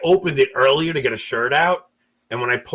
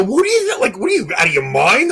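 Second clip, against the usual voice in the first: mock outrage; 8 semitones higher, 5 dB louder than usual. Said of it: "I go".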